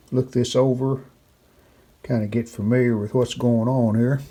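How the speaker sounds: usual speaking voice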